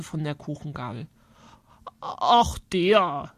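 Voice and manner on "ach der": lightly acted full-mouthed uncle; a touch of mouth-full speech. A narrator's reading. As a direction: talk as if through a bite of cake. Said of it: "sagte Onkel Berthold mit Vollmund".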